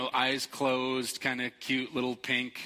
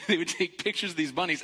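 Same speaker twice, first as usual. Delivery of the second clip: ordinary talk, nothing marked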